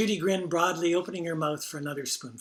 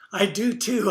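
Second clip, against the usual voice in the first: playful; 5 semitones higher, 7 dB louder than usual. Retold or imitated. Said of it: "just as playfully".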